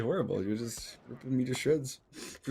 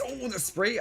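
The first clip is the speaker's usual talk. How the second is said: excited; 12 semitones higher, slower than usual. Real, not acted. Caster reacting to a spray.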